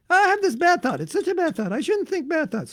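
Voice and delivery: high, whiny mock lament; self-pitying devotee. A devotee beating himself up over a thought, high and whiny. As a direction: wail it like a hammed-up guilty devotee. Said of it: "what are you thinking about".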